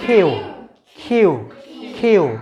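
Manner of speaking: কিউ with a strong puff after the k; model Q. Shown as a demonstration. Teacher answers how we say Q, aspirated কিউ. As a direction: push air into the k of কিউ, gliding to "yoo". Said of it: "কিউকে আমরা কি বলবো?".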